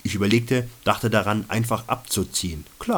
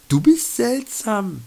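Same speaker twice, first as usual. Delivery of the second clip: higher, lightly voiced female companion stating a fact; a higher voice for the companion, dry observation; companion's voice; 11 semitones higher, 3 dB louder, slower than usual. Companion's verdict quoted. (Begleiterin, höher gesprochen, trocken feststellend)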